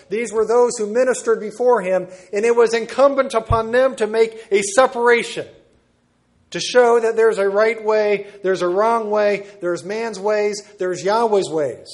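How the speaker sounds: rising fiery preacher voice; preaching at full force. Acted. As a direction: drive it home from the pulpit.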